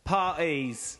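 Cockney with the middle consonant dropped in "Parties"; put-on Cockney glottal stop; mock Cockney sweep; Cockney accent. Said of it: "as many consonants as you can cut out".

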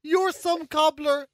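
high, drawn-out words through laughter; laughing in disbelief. Real, not acted. Host cracking up at co-host's odd phrase, laughing. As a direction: squeal it out slowly while cracking up.

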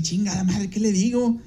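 repeating the question, voice up in mild panic; a touch of flustered panic; flustered thinking aloud, what do I say; panicked inner thought. Retold or imitated. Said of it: "Y yo así".

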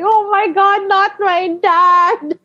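high-pitched comic dread, last words stretched; mock dread. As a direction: whine it as if doom is coming. Retold dread as dad is woken.

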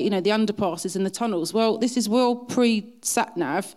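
ordinary delivery

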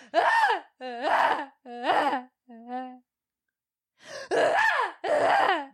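high, broken mock sobs in waves; imitated sobbing. Mom imitating her daughter crying in her room, mock sobs. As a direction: ham up a child's dramatic wailing. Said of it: "and it's like".

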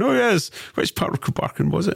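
high, eager know-it-all asking about a village; mock local historian. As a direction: play a keen local historian who knows every village. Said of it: "talking as though you're a Renfrewshire historian".

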